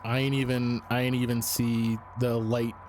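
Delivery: normal talking voice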